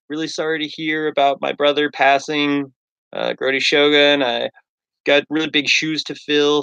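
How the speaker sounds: hammed-up replacement brother stepping in; new brother character. Fake-mustached brother greeting the followers, cheery put-on voice. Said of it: "And I'm like".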